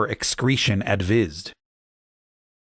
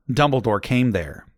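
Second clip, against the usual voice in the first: plain reading voice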